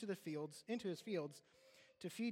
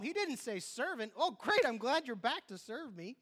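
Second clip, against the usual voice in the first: fervent preaching, higher and louder; preacher building up the congregation, high and loud; 6 semitones higher, 6 dB louder than usual. Acted. Preaching at full force.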